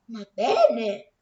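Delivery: loud exclamation. Acted. Father scolding his daughter, loud exclamation. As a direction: burst out at the daughter in sudden annoyance. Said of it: "esclamò il papà".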